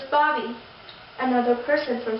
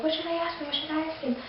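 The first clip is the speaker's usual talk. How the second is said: inner thought; 3 semitones higher, 7 dB quieter, faster than usual. Retold or imitated. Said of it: "thinking in my head".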